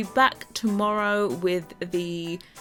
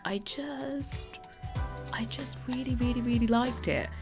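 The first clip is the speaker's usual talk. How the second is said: whispering; 4 semitones higher, 7 dB quieter than usual. Real, not acted. Gushing softly over favourite build.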